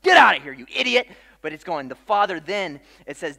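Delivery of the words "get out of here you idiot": angry shout. Retold or imitated. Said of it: "been like".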